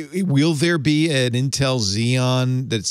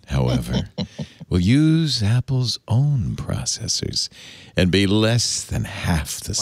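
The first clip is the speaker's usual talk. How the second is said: sexy voice; slower than usual. Acted. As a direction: purr it like a late-night radio host. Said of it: "as I do a dramatic reading of Rumor".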